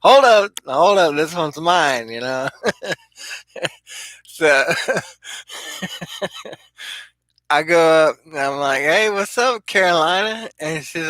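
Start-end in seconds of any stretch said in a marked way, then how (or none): 0.0-2.1 high, loud call to butt in; mock cutting in. Teller butting in on his boss's flirting, mock cutting in. 8.8-10.5 high-pitched, sing-out hello; retold flirty greeting. Man greeting a Carolina woman at the casino, retold flirty greeting.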